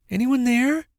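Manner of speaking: high, questioning call. A narrator's reading. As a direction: call out to whoever might be there. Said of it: "she called out".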